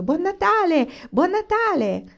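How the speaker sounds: high, loud Christmas cheer; joyful shouting. A narrator's reading. Children run downstairs shouting Merry Christmas, high joyful shout. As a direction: shout it out in high-pitched delight. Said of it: "Scesero gridando".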